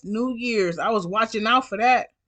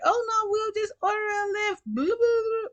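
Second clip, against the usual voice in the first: much higher sing-song mocking voice; mocking drunk rider; 12 semitones higher, 5 dB quieter than usual. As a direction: parrot them with a sneering high whine.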